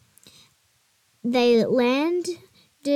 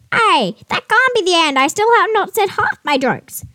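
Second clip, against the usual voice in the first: high, loud squawky parrot; 8 semitones higher, 7 dB louder than usual. Acted. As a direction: protest like an indignant parrot. Parrot protests.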